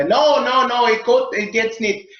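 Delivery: hammed-up German scientist insisting; German accent. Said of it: "I always have to do the German accent".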